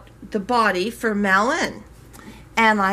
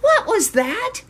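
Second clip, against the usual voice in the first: much higher, surprised quoting of a friend; startled question; 12 semitones higher than usual. Retold or imitated. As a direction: toss it up high like she just heard something odd. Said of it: "Katie calls me back and says".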